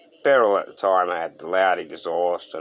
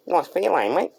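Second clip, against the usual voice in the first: higher, squeaky teen boy imitation; squeaky teenage voice; 8 semitones higher than usual. Teen kid complimenting the car, squeaky imitated voice. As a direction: mimic a kid whose voice hasn't settled. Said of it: "in his squeaky little teenage voice".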